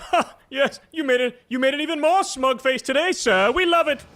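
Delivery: high, fawning mock praise; mocking fawning pundit. Host sneering at right-wing pundits, high fawning mock praise. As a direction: ham up a smug fan's approval. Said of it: "they're like".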